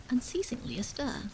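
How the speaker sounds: usual speaking voice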